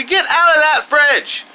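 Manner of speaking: parental yell. Real, not acted. Reviewer interrupting himself to yell at kid, real shout. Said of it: "I gotta go yell at this kid some more".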